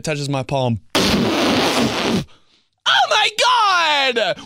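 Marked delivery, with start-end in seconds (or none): 2.9-4.4 much higher, louder shriek; shrieked Oh my God. Real, not acted. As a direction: shriek it in disbelief. Shouted exclamation.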